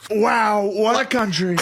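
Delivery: Trump rally voice. Shown as a demonstration. Showing Trump's rally delivery, shouted high. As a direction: shout it like a rally crowd-pleaser.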